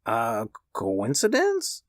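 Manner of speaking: stammering. A narrator's reading. Old man doubting his wife, stammering. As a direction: falter over it slowly. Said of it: "stammered the old man".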